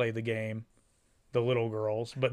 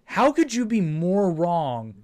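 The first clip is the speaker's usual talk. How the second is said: mock indignation; 5 semitones higher, 4 dB louder, slower than usual. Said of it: "And I'm like".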